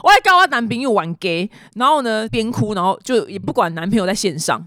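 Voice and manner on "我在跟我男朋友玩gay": retold reply in Taiwanese, opening loud; switching to Taiwanese. Crying girl explains herself to the neighbour, in Taiwanese. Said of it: "从英文切换到台语说".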